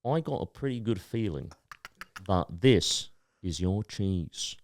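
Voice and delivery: slow, soft put-on accent, stretched out; hushed showman in a put-on accent; Cheese Man accent. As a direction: put on the performer's accent, quiet and deliberate. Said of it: "I gotta do his accent".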